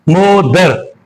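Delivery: मदर broken into two beats, mo, ther; मदर with a gap between its two parts; split into syllables. Shown as a demonstration. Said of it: "pronounced in two attempts".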